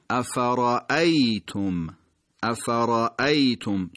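افرأیتم said slowly, with a sharp, separated hamza; crisp glottal stop in افرأیتم; slow, exact افرأیتم; sharp hamza demo. Shown as a demonstration.

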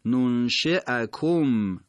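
ننشئکم slow, with a clear fatha hamza in the middle; slow, exact ننشئکم; medial hamza demo. Shown as a demonstration.

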